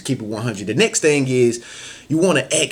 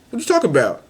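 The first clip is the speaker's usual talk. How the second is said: playing dumb; 8 semitones higher, 3 dB louder, faster than usual. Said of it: "that person might be like".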